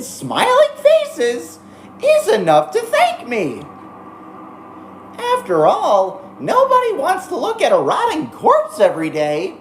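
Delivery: squeaky, gleeful mascot villain; Monokuma voice. Acted. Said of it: "Monokuma impression, thank you".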